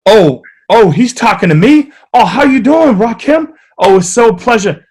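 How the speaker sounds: high, eager fanboy reply; starstruck. Retold or imitated. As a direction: gush like a nervous fan meeting a hero. Starstruck self answering [name].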